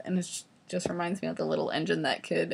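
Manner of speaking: ordinary talk, nothing marked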